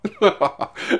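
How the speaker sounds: real laugh. Real, not acted. Laughing at 200,000 fines.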